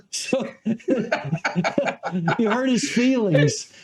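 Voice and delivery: laughing through the words; amused, breaking into laughter; laughing at his story, teasing him. Real, not acted.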